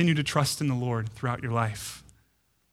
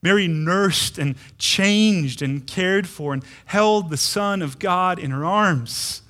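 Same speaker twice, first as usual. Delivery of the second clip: ordinary delivery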